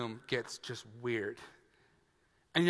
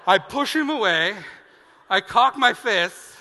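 ordinary talk, nothing marked

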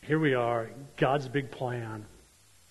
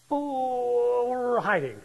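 sheepish Adam; 10 semitones higher, 5 dB louder, much slower than usual. Retold or imitated. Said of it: "Adam answers God".

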